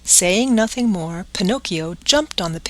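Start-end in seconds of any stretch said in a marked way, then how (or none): none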